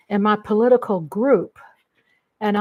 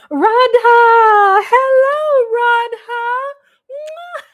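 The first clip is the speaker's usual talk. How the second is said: much higher, louder delighted greeting; excited shout; 13 semitones higher, 9 dB louder, much slower than usual. Real, not acted. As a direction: shout a joyful hello to sister Radha. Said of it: "do you see how I am when I see everybody".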